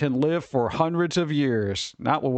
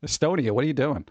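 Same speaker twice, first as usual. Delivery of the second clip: ordinary talk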